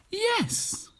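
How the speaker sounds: a sudden very high squeal of yes; an eager witch yelps her yes; witch crying yes. Acted. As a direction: fling it out way up high. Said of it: "cried the witch".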